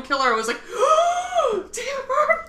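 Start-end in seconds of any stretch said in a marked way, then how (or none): 0.7-1.7 high excited gasp; sharp gasp. Retold or imitated. Reacting to [name] song. 1.7-2.5 high thrilled exclamation; excited. Retold or imitated. Retold excited reaction.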